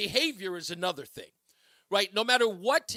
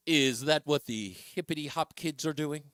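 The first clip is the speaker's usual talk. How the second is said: lightly voiced out-of-touch square; a hint of a clueless old man; mocking old fogey; 5 semitones lower than usual. Shown as a demonstration. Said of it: "When I call it the hip hop".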